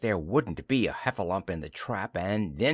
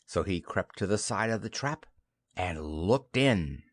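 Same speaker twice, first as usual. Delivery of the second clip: ordinary delivery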